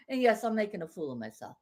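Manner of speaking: ordinary talk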